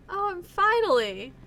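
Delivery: loud, slow, relieved cry; finally, at last, stretched out; excited exclamation. Real, not acted. Player reacting to the name pun, loud and slow.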